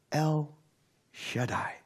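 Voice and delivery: softly whispered, in awe; hushed whisper. Acted. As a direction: whisper the name El Shaddai softly, almost breathless. God's name repeated as hushed whisper.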